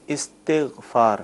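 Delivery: استغفار said slowly as istighfaar, short i under the alif; correct pronunciation. Shown as a demonstration. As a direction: sound out استغفار with an i at the start, long final aa. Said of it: "یہ صحیح تلفظ ہے". (استغفار کا صحیح تلفظ، الف کے نیچے زیر کے ساتھ، ٹھہر کر)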